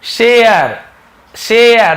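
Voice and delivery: शेयर loud and high, vowel stretched to AY-uh; शेयर said emphatically, slowly falling; demonstrated gliding vowel. Shown as a demonstration. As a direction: teach शेयर with a drawn-out एय glide. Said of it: "ए ध्वनि भी निकलता है".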